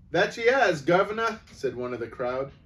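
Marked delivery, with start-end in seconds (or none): 0.1-1.4 high, loud cheeky cockney voice; amused onlooker calling to the shopkeeper; cockney bystander. Acted. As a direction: relish the scene, loud and common.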